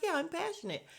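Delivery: high-pitched echo of a husband; mock eager agreement. Retelling husband agreeing with his wife, mock eager agreement. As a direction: chime in high, imitating him. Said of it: "And he's like".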